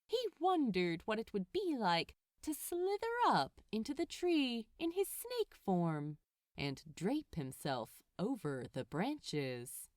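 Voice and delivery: ordinary delivery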